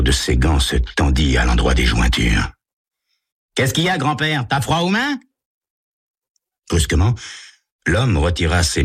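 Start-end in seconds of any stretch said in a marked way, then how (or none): 3.5-5.2 put-on high cocky teen jeer; taunting call. Tommy taunts the driver next to them, high and cocky. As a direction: jeer at the old man across lanes.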